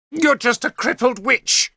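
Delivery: high shrill screaming accusation; screamed insult. A narrator's reading. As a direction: scream it at the crippled witch. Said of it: "she screamed at Morgan".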